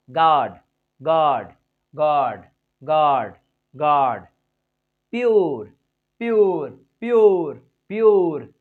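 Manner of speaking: loud falling model of "गॉड" and "प्योर"; pronunciation drill. Shown as a demonstration. Teacher drilling words for learners.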